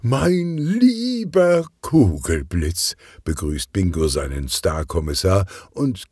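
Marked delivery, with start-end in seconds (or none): 0.0-3.0 much higher, slow and gushing; open-armed grand greeting; effusive boss. Acted. The director gushes over his star inspector, higher and slow.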